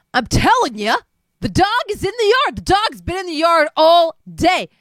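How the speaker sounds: loud, high and rushed; pleading that the dog stayed home; exasperated insisting. Acted. Frank insisting to Greg the dog stayed in the yard.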